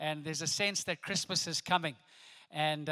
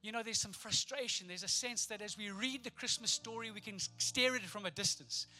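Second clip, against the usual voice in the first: softer, intimate sermon voice; a hushed, heartfelt appeal; soft preaching; 4 dB quieter than usual. Acted. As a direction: draw them in quietly over the music. Soft preaching over music.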